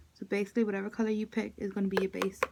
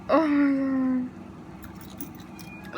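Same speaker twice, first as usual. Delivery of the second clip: laughing until near tears; gasping out words between laughs; 4 semitones higher, much slower than usual. Real, not acted. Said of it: "I can't stop laughing".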